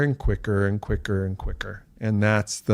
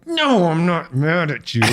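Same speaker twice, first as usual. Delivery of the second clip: slow, higher, strained denial; mock forced calm; 8 semitones higher than usual. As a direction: insist you're fine through gritted teeth. Denying anger while fuming.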